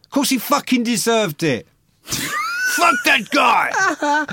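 loud, high, indignant outburst; outraged shout. Real, not acted. Backing the woman who punched Prince, outraged shout.

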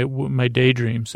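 ordinary talk

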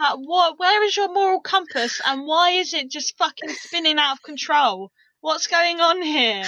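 high, loud, incredulous demand; indignant rant. Real, not acted.

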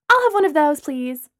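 chirpy and curious; Lana. Acted. Lana asking Brooklyn about the drink.

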